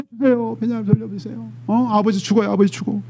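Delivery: hushed, broken plea for Benjamin. Acted. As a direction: beg quietly, almost spent. Judah's plea, fading.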